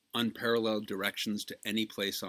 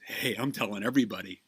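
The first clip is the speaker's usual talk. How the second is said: put-on louder, fast punchline in an old man's voice; 2 semitones higher, 6 dB louder, faster than usual. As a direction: bark out the punchline like a cheeky old man. Joke punchline, old man.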